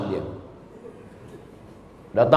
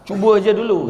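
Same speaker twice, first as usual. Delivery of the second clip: put-on older ustaz urging; 6 semitones higher than usual.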